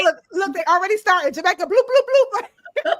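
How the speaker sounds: high, loud, bouncy bloop bloop chatter; playful excitement. Pretend. Host hyping the Jamaican viewers, high excited chatter. Host mimics comments flooding in.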